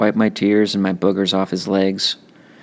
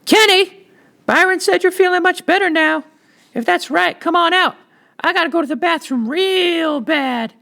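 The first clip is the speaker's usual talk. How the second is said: a little sister calling her brother out of the bathroom, much higher. Acted. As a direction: call through the door like an impatient little sister. Joetta banged on the bathroom door.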